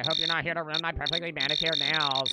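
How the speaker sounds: put-on loud, haughty rich girl voice; hammed-up spoiled socialite; rich designer-loving woman. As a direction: play the affronted diva, starting loud. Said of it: "she's a rich designer-loving woman".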